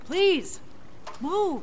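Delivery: pointed, separated Please and move; insistent. Acted. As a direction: press each word firmly. Insisting swimmer move aside.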